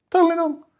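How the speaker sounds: sarcastic high squeak of it; high mocking repeat.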